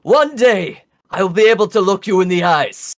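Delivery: high, grand heroic proclamation; epic shout. Acted. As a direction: declaim it to the dragons. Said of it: "You're just shouting epicness at the dragons".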